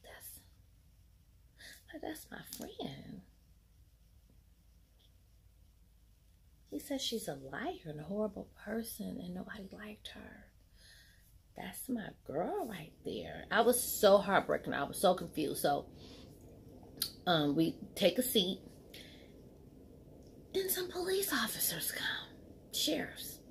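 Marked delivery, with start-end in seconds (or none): 0.0-13.4 hushed, breaking whisper; grieving whisper. Real, not acted. Looking at her friend in the casket, heartbroken whisper. 20.5-23.4 high whispered suspense; hushed reveal. Real, not acted. Telling viewers about the police arriving, hushed.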